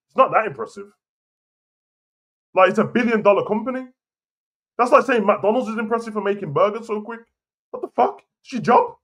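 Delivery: exasperated rant. Real, not acted. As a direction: scoff at the hype, pitch climbing.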